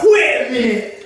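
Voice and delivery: high, slow laughing double take; surprised outburst. Retold or imitated. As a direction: catch on mid-act, laughing. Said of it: "then you'd be like".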